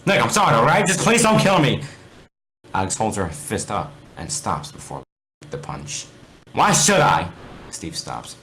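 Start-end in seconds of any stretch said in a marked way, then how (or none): 0.0-1.8 high, loud desperate begging; frantic pleading. Acted. Steve begging for life. 6.5-7.3 high, loud cold demand; hostile challenge. Acted. Alex threatening.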